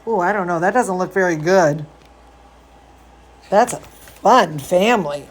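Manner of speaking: plain reading voice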